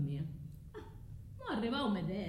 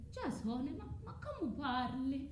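hurt disbelief; 4 semitones higher, 4 dB quieter than usual. Acted. Medea shocked at Jason's talk.

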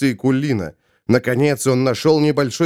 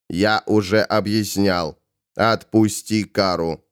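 slow, low command; measured, unmoved order; firm Ravenor; 5 semitones lower than usual. Acted. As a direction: lay it down slowly and low. Ravenor orders Kara released.

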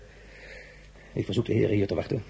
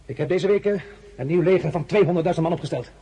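proud loud boast; 9 semitones higher, 5 dB louder than usual. Acted. Emperor boasts of his new army.

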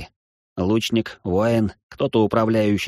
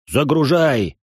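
loud, high command; barked order; 5 semitones higher, 4 dB louder, slower than usual. Acted. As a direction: order the crew to load. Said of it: "скомандовал Дартун".

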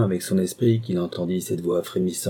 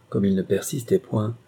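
ordinary delivery

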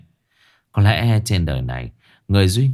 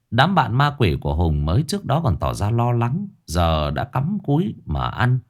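ordinary delivery, nothing marked

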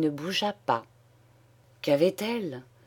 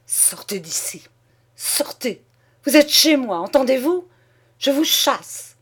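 sputtered then exploding into a higher, louder yell; stammering fury; 6 semitones higher, 4 dB louder than usual. Acted. Husband throwing his father-in-law out, sputtering furious shouts. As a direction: spit it out, choking on rage. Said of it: "en bredouillant".

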